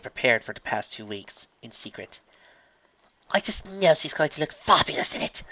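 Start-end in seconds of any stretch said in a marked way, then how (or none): 3.3-5.4 higher, gushing ladylike voice; excited dressmaker thought. A narrator's reading. Dressmaker thinking of her secret gift, delighted. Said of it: "the dressmaker thought to herself".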